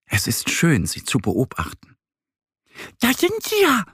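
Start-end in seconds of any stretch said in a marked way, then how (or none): 3.0-3.9 hohe, helle Entenstimme, freudig gerufen; freudiger Ruf der Ente. Acted. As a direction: ruf es froh wie eine kleine Ente. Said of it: "ruft Annie freudig". (fröhlich rufende Ente)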